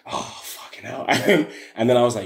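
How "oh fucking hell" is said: muttered curse. Retold or imitated. Retold exasperation in standoff.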